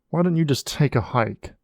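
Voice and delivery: ordinary delivery, nothing marked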